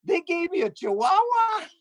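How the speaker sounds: high, shocked squeal of disbelief; hammed-up astonished high cry; mock shock. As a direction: pretend blind man discovering the dog, pitch way up. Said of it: "So I said".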